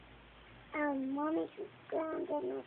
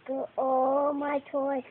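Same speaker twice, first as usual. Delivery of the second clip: loud, drawn-out retold command; quoting mother's order; 13 dB louder, slower than usual. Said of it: "she told me".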